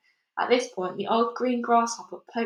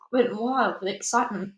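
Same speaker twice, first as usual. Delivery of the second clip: ordinary delivery, nothing marked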